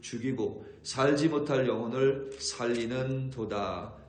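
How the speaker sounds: loud, slow preaching recitation of the verse; fiery pulpit reading of Ezekiel; scripture reading. Acted. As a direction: proclaim the prophecy with weight. Reads Ezekiel with force.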